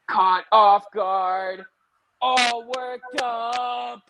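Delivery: slow, rising to a full shout; loud shout. Acted. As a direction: belt it out until the mic clips. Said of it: "you're blowing out your own speaker".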